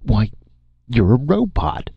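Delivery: gasped. Acted. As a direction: gasp it out, astonished. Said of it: "he gasped".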